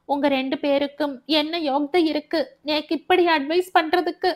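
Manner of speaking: high-pitched protest of a wounded woman; acted outraged refusal, pitched high throughout; distraught, indignant Tulasi. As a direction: voice her refusal high, at ordinary volume.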